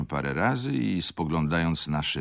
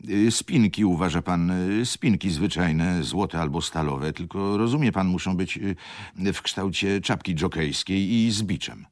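higher, hesitant aristocratic baron with ums; fussy baron; 5 semitones higher, 6 dB louder than usual. Acted. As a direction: play the dithering baron fishing for words. Baron describes cufflinks, hesitant.

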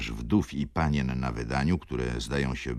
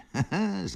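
sudden high burst of laughter; short laugh. A narrator's reading. Silent guest at the table suddenly laughing. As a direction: let out one quick laugh. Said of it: "Zaśmiał się ktoś dotąd milczący".

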